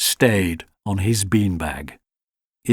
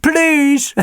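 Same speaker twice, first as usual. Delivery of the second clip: begging dog; 5 dB louder than usual. A narrator's reading.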